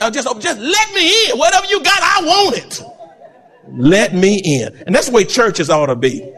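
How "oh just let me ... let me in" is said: pleading man. Retold or imitated. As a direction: beg to be let in, voice lifted high. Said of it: "And he just".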